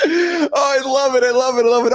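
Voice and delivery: high-pitched, laughing glee; laughing delight. Real, not acted. Host reacts to co-host's AI girlfriend joke, laughing delight. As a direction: crow it through real laughter.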